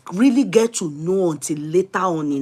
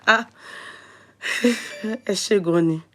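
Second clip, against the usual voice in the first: ordinary talk, nothing marked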